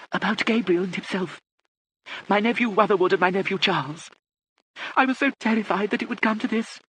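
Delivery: very high-pitched aristocratic lady; deaf old lady. A narrator's reading. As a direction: play a deaf Lady Catherine, pitched far up.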